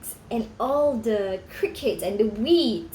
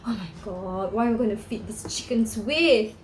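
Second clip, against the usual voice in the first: plain reading voice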